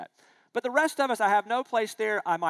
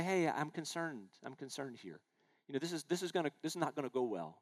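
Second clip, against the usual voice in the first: gentle concern; 4 semitones lower, 10 dB quieter than usual. Shown as a demonstration. Gentle caring rebuke to friend.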